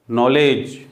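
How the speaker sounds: नॉलेज as "nol-ej", no d heard; silent D. Shown as a demonstration. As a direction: drop the d in नॉलेज. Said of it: "डी और जी आया है". (नॉलेज में डी silent)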